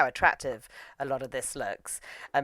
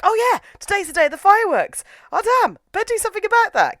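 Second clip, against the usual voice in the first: loud, high, hurried realization; mock sudden panic; 8 dB louder, faster than usual. Sakura suddenly remembers the fireworks, loud mock panic. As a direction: exclaim it as if you just remembered.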